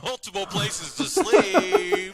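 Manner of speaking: cheesy announcer voice. Acted. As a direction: boom it out like a game show host. Continues the announcer read.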